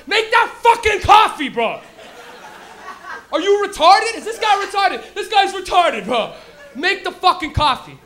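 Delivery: yelling at the guy for being slow; furious shout. Acted. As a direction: blow up at him over the coffee. Yelling at coworker without morning joint.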